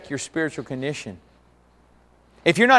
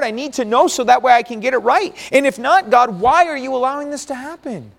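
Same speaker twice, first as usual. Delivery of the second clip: raised, imploring cry to God; pleading with God; 6 semitones higher, 4 dB louder than usual. Retold or imitated. Job crying out to God in his suffering, raised and pleading. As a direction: plead like Job, rising in pitch. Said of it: "he looked up and said".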